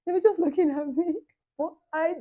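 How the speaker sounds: high laughing retelling; giggling high and loud. Real, not acted. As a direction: laugh through the words. Laughing while recounting sisters.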